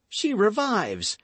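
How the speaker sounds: high, slow burst of relief; joyful cry. Acted. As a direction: cry out in sudden hope. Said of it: "cried the young man".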